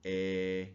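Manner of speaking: "apron" opening on a drawn-out letter-name A, "ay"; "apron" with its first vowel held as "ay"; long A. Shown as a demonstration. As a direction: stretch the long "ay" that starts "apron". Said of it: "a long vowel, you're gonna say the letter".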